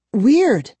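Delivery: high, loud exclamation; exclaiming. A narrator's reading. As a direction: blurt it out amazed. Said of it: "Kara exclaimed".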